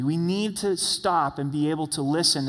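ordinary talk

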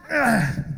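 effortful throaty grunt; acted straining grunt. Praying man forcing a shut door, straining grunt. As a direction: grunt as if heaving at something stuck.

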